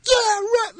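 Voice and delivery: very high-pitched mock scream; imitated scream. Director imitating a woman cheering at the screen, screaming. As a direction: shriek it like a worked-up moviegoer. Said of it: "it was like screaming".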